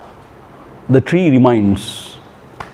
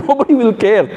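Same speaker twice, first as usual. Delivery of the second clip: laughing; 5 semitones higher, faster than usual. Real, not acted. Joking about people glued to phones.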